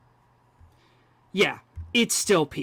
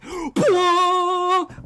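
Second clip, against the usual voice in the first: high, loud, sustained "bwah"; imitated organ blast; 12 semitones higher, 4 dB louder than usual. Fan re-enacting the film's score, imitated organ blast. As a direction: gasp in, then blare like a huge organ.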